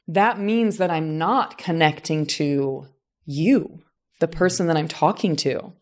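ordinary delivery

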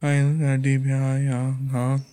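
low, quiet phone-answering voice; imitated phone answerer. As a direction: mumble it softly, trailing into uhs. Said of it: "they answered the phone".

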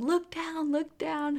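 urgent call, pitched high; high, urgent mock shout from the delivery team; retold yell. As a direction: call it out like the nurses urging her. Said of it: "they started yelling".

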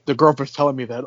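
ordinary talk, nothing marked

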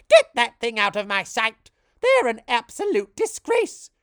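high, loud furious scream. Acted. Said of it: "Vera screamed".

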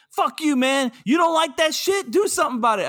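high-pitched, hammed-up confrontation; mock aggressive. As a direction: throw out a mock challenge, voice pushed way up. Acts out hostile redneck's reply.

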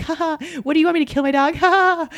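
high, fast mock-laughing mom; a mother jokingly offers to kill her dog, laughing. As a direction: toss it off as a silly joke, laughing. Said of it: "It was more like".

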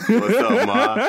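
loud, high teasing "What's up, ma"; hammed-up flirty call-out; flirty pickup line.

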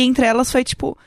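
ordinary talk

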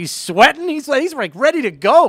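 rising excited teasing. Acted. Teasing the overexcited fan.

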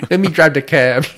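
put-on loud, high New York cab driver accent; hammed-up New York cabbie pushing in. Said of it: "like somebody from New York".

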